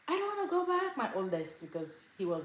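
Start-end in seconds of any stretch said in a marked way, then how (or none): 0.1-1.0 high-pitched imitation of a five-year-old; little kid. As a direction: whine it like a small child refusing. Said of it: "my kids would say".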